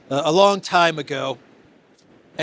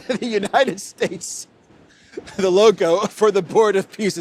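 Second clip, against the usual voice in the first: laughing; 4 semitones higher than usual. Real, not acted. Amused host laughing at the Board of Peace logo. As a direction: chuckle out the punchline. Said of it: "just a map of the United States".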